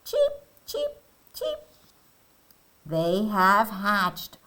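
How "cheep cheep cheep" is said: tiny, very high chirps; baby chick cheeps. Acted. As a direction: peep like a newly hatched chick. Chicks hatch in story.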